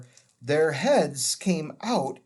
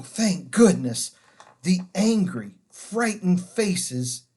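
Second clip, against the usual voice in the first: plain reading voice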